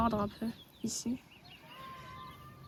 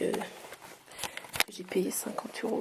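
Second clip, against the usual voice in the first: whispering; much slower than usual. Real, not acted. Whispering, sick voice.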